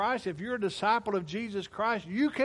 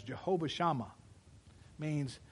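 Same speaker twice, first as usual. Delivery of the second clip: ordinary talk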